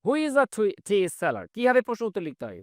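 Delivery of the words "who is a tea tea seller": normal talking voice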